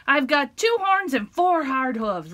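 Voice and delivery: loud, slow bold boast. Acted. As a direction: boast slowly and loudly. Big goat boasts.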